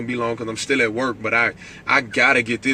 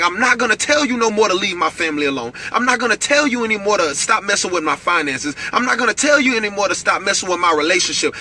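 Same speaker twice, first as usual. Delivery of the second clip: stern warning; 7 semitones higher than usual. Shown as a demonstration. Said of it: "it starts getting louder and louder".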